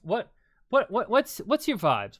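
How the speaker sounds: excited. Real, not acted. Excited repeated question to co-host.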